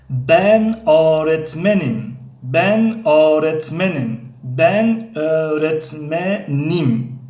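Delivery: Ben öğretmenim spoken very slowly, Ben stressed; slow model sentence. Shown as a demonstration. Teacher modelling Ben öğretmenim slowly for the class. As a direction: drill it slowly for students to repeat. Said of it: "Turkish model sentence for learners".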